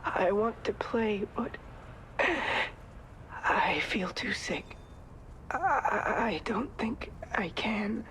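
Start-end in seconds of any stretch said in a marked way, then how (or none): 1.6-3.4 long unvoiced sigh; tired whispered sigh; weary sigh. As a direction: sigh out, worn down. Sick character sighs.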